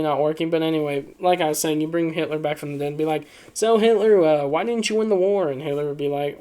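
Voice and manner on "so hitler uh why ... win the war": high, loud interviewer voice; put-on host voice, pitched up; talk show host. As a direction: launch the interview like a post-game host. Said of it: "it's like a post-game, uh, talk show".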